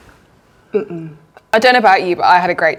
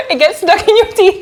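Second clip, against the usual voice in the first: laughing hard; 11 semitones higher, 5 dB louder than usual. Real, not acted. Laughing at Vegemite taste.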